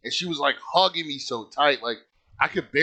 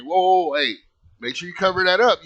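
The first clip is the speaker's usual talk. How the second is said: higher, louder retold dad warning; urgent caution; 5 semitones higher, 5 dB louder than usual. Dad stopping his child from showing everybody, urgent caution. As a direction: cut in to halt the kid.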